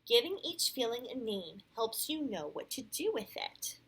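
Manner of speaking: clear, animated read-aloud; storybook voice with lively rises and falls; picture-book narration. A narrator's reading. Parent reads Sam's story to a child, animated storytelling.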